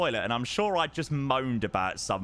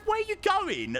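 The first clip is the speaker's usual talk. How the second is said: sharply higher, demanding question; indignant confrontation. Retold or imitated. To the man pushing past in the aisle, indignant and high. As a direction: stop him in the aisle. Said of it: "I said".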